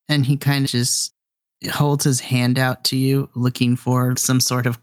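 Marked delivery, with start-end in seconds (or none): none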